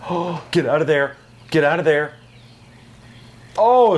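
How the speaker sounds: usual speaking voice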